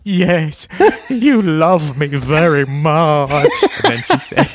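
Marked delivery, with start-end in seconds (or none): 0.0-3.3 put-on high, loud, slow childlike yes; mock eager reply. Mocking his own reply to the video, high and loud. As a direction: play the grateful child, hammed-up.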